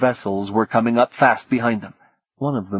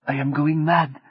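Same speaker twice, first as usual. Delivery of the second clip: higher, hushed despairing line; mild despair; 5 semitones higher than usual. Acted. Martine breaking down.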